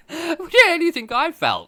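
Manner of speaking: high, loud through laughter; laughing high. Real, not acted. Laughing back at the stunned host, high and loud. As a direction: squeal it out laughing.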